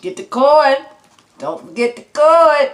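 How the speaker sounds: high and loud, hyped about the corn; excited call. Real, not acted.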